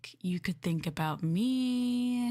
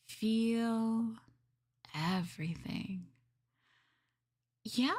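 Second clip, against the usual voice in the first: ordinary talk, nothing marked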